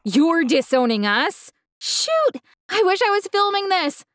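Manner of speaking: slow, amused, then a high scoff on "Shoot"; dad mocks his daughter's anger; mocking. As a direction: laugh her off. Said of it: "My dad laughed".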